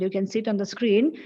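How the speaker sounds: normal talking voice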